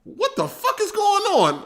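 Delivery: high startled what-the-fuck; retold disbelief. Streamer retelling his shock at the likes, high and startled. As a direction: act out seeing a thousand likes, shocked. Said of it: "At first, I'm like".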